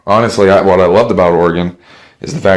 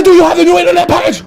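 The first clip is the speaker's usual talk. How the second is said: put-on German accent, much higher, louder and fast, barking the question; angry German shout; 3 dB louder, faster than usual. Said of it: "I said".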